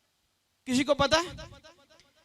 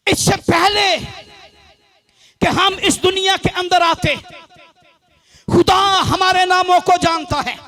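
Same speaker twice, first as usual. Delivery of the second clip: raised, emphatic preacher's delivery; preaching; 3 semitones higher, 6 dB louder than usual. Real, not acted. Preacher declares God knows our names, high-energy preaching. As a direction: proclaim it in measured phrases with pauses.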